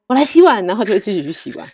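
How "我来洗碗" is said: eager husband. Retold or imitated. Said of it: "他就自己会去看说".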